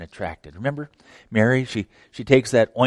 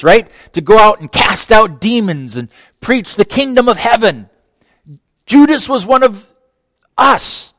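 loud, high, building sermon voice; fervent preaching; 8 semitones higher, 9 dB louder than usual. Acted. Preacher stressing Judas was one of us, loud and rising. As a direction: drive it home to the church.

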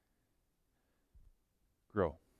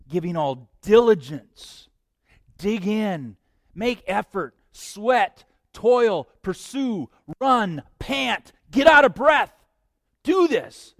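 from firm to shouting higher and louder; building exhortation; 6 semitones higher, 10 dB louder, slower than usual. Acted. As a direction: whip the crowd up word by word. Preacher builds exhortation to climax.

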